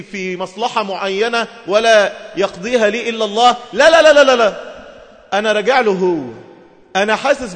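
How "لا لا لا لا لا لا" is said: loud, higher run of firm no's; emphatic no. Real, not acted. Preacher loudly rejecting repenting for worldly gain. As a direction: shut the idea down, loudly.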